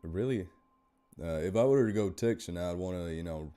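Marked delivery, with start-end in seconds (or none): 1.4-3.5 Texan with a southern twang; Texan accent. Shown as a demonstration. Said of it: "get that southern twang in there".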